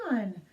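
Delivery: long high yawning sigh; big pretend yawn; acted yawn. Reader acts out a yawn.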